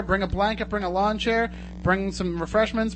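normal talking voice

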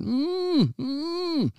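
high-pitched muffled moaning; acted whimpering "mm" of a captive; muffled moan for help. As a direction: moan high through a closed mouth. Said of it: "Nettie moaning for help".

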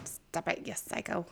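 soft, voiceless whispered scolding; hushed scold. Real, not acted. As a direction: whisper it so no one hears. Hushed teasing between partners.

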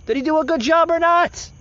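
high, loud and fed up; loud, high exasperated demand; exasperated shout. Real, not acted. Exasperated at the dodged question.

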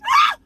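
a sudden high, loud ah; fervent cry. Real, not acted. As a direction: cry out as the spirit hits. Preacher crying out in fervor.

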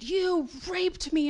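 high, loud, slow accusation; retold accusing shout. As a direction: fling it at him, each word slow and hard. Confronting father about abuse.

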